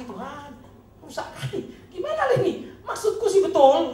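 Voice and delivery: high, loud, laughing prayer; laughing mock prayer. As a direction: laugh through a mock complaint to God. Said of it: "itu doa lagi".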